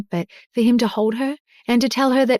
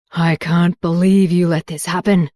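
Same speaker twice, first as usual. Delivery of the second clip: low, louder accusing father; angry shout; 3 semitones lower, 4 dB louder than usual. A narrator's reading. Father accusing daughter, low and shouted. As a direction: blame her with a shout. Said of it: "he shouted".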